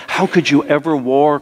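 ordinary talk, nothing marked

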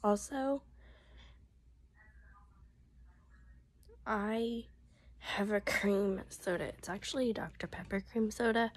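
hoarse, half-whispered voice; losing her voice, pushing through; voice giving out. Real, not acted. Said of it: "am losing my voice".

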